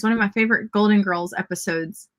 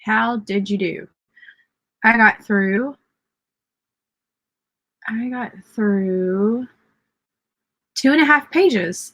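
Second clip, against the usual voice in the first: ordinary talk, nothing marked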